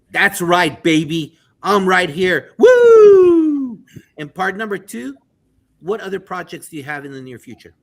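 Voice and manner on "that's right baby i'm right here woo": joyful cheer. Retold or imitated. Host voices the teacher's triumph, loud whooping cheer. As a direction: shout it like you just won. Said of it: "what made you know that".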